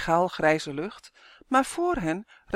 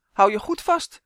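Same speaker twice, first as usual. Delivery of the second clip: louder firm instruction; raised-voice command; calling out; 3 semitones higher, 6 dB louder than usual. A narrator's reading. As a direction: call a warning to hold on. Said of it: "riep hij".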